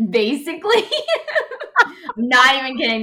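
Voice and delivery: loud, high, through real laughter; laughing. Real, not acted. Telling friends about a childhood party trick, laughing. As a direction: insist while cracking up. Laughing while confirming the story.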